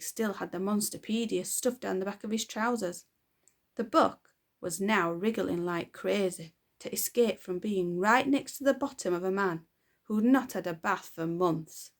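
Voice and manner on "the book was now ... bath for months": ordinary delivery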